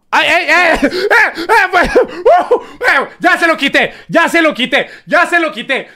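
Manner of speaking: high, laughing yelps; real laughing excitement; laughing panic. Real, not acted. Laughing panic over mishap.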